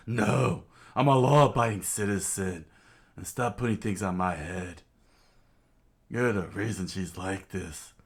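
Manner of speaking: loud, affronted opening, then grumbling scold; blustering village cop; indignant lawman. Acted. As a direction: protest like an offended law-abiding constable. Genzo indignantly answers Belmere.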